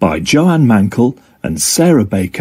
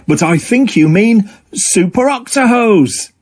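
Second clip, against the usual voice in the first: higher, playful proud octopus voice; proud teasing; 10 semitones higher than usual. Acted. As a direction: correct her with a proud grin. Octo jokes proudly.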